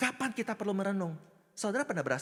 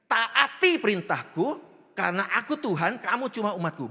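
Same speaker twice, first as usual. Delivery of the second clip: perintah otoriter; 3 semitones higher, 6 dB louder than usual. Acted. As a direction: proclaim a command as the absolute ruler. Acting God as domineering commander. (titah tegas, pura-pura sok kuasa)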